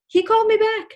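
high, loud, thrilled exclamation; real amazed excitement. Real, not acted. Amazed climax of her story.

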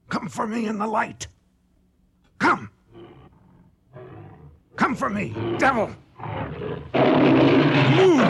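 high, loud desperate screams; frenzied shouting. Acted. Hunter daring the devil to come into the light, frenzied screaming. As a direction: hurl every word at the beast.

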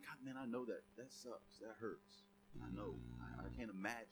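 hushed, soft, comforting words; gentle sympathy. Retold or imitated. Counselor comforting an upset friend, soft and hushed. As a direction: soothe them, almost whispering.

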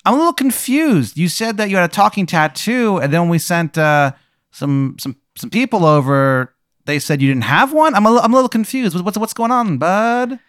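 high, chipper customer-service voice; corporate caller voice. Acted. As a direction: GM voicing the Lanolax rep on the phone, high and chipper. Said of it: "and he goes, uh".

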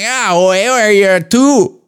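high, slow mock Toronto street accent; Toronto mans accent. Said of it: "a Toronto man's".

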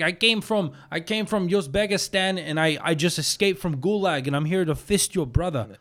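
mock Russian tough guy; comic Russian immigrant introduction; Russian accent. Shown as a demonstration. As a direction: demonstrate a thick put-on Russian accent. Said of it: "You say things like".

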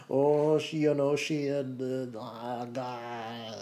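drawn-out, wavering stab at Scottish; badly faked Scottish mum, trailing into noises; attempted Scottish accent. Shown as a demonstration. Asked to do Scottish mum, joke Scottish accent. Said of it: "That was my Scottish accent".

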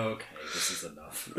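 mumbled low and soft like a worn-down father; meek dad giving in. Retold or imitated. Podcaster imitating the weak-willed dad, softly and low. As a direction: play the timid dad quietly putting his foot down.

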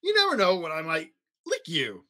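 mock-scary monster, only half menacing; a lightly hammed-up spooky monster; trying to sound terrifying. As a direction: try hard to be scary and fall short. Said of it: "trying to sound terrifying".

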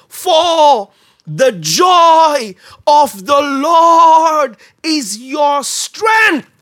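very slow, loud shouted declaration. Retold or imitated. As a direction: stretch every word and shout it. Climactic quote shouted.

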